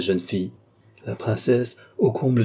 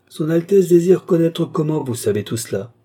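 high, raised relayed question; commanding repetition; 8 semitones higher, 5 dB louder than usual. A narrator's reading. Count relaying the princess's question to Lorenza, commanding. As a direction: repeat the question as an order.

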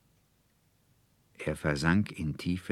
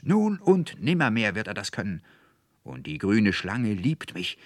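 raised, insistent cry; shouting; 5 semitones higher, 6 dB louder than usual. A narrator's reading. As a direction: declare it loudly and higher, with conviction. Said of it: "schrie der Student Anselmus".

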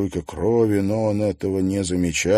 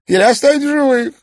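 high, loud gloating villain; theatrical villain; 12 semitones higher, 8 dB louder than usual. Acted. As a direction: relish every cruel word. Toymaster continues.

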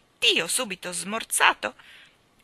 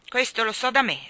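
louder, higher, impatient royal huff; 4 semitones higher, 7 dB louder than usual. A narrator's reading. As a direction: puff it out, irritated. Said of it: "sbuffò il re". (sbuffando, seccato)

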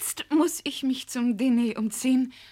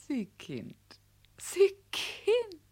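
laughing fondly; 3 semitones higher, slower than usual. Acted. Flattered hostess laughing at poet.

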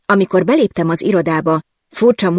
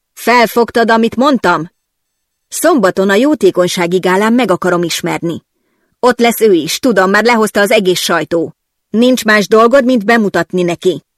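higher, louder insistent command; loud boss orders; 3 semitones higher, 4 dB louder than usual. Acted. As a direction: drive the orders home. (parancsolóan, hangosan)

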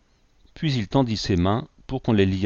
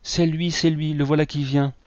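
excited cries; 7 semitones higher than usual. A narrator's reading. Guests at the feast shouting he has come. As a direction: call out that he has arrived. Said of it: "des cris retentirent".

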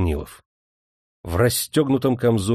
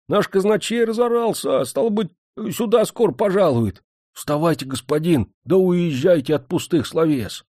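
higher, animated servant voice; grumbling servant; 7 semitones higher than usual. A narrator's reading. Servant waking his master as the steward approaches, urgent grumbling.

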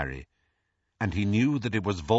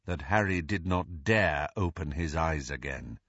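ordinary delivery

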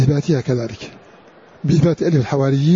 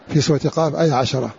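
ordinary delivery

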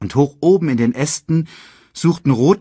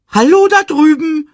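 calling out; 10 dB louder than usual. Acted. Timon calls Simba.

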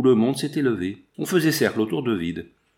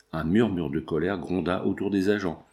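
plain reading voice

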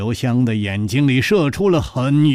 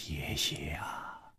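very soft, low, drawn-out whisper; musing maybe; 7 semitones lower, 19 dB quieter, slower than usual. A narrator's reading. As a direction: trail it out slowly under the breath. Character muses on being believed.

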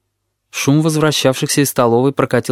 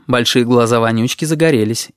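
ordinary delivery, nothing marked

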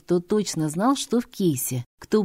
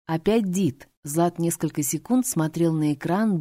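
plain reading voice

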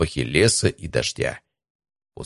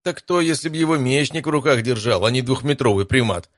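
higher-pitched taunt; can't-resist jab at a friend; barbed quip; 7 semitones higher than usual. A narrator's reading. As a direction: needle him with a raised, teasing pitch. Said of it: "не удержался от очередной колкости Стас".

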